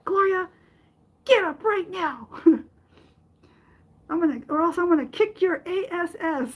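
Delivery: high, fast mock yell with a threat; a mother's mock yell ordering her daughter up off the floor; mom yelling. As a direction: playfully threaten like a tough mom. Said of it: "She yelled at me".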